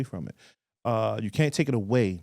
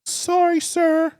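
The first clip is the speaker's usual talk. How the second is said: high, louder mock of a scared man saying sorry; mocking apology; 5 dB louder, much slower than usual. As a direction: squeak it out like a cowering driver. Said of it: "That's how he was".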